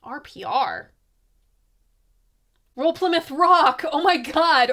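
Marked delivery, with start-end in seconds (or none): 2.8-4.7 loud, higher-pitched outburst; sudden exclamation of exasperated recognition; exasperated realization. Real, not acted. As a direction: blurt out the answer as it finally clicks. Suddenly works out abbreviation in notes.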